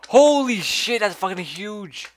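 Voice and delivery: high, loud, astonished outburst; jaw-dropped shout, slowing on huge; amazed shout. Real, not acted. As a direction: gasp it out in real disbelief. Finding something enormous.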